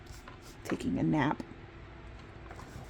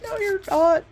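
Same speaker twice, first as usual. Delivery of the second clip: high, loud sulky retort; a sulky comeback to mom's sympathy; mock snippy retort; 12 semitones higher, 8 dB louder, slower than usual. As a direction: snap back like a sulky teen. Snapping at mom.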